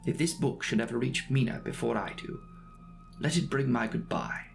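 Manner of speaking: usual speaking voice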